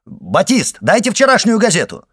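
high, loud call to someone out of sight; shouted order. A narrator's reading. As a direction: call out sharply for an assistant. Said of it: "крикнул Башан".

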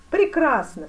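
loud, high exclamation; cry of approval. A narrator's reading. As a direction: cry it out with force. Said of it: "вскричал великий князь". (громко вскричав)

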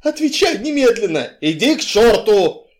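loud, high angry exchange; shouting match. A narrator's reading. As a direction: fire it off at full volume. Shouting match between quarrelling couple.

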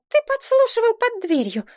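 put-on very high, outraged child; indignant. A narrator's reading. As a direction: accuse dad in a high, scandalised voice. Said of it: "возмутилась Элька". (возмущённо, высоким голосом)

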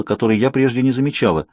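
plain reading voice

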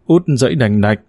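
plain reading voice

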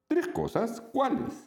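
very high, curious little monster; surprised childlike questions; friendly monster voice. Acted. Monstruo pregunta sorprendido. (voz muy aguda de monstruito curioso)

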